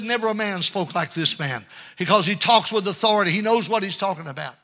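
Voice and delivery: ordinary delivery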